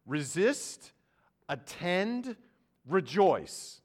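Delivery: loud proclamation. Acted. Announces the three headings.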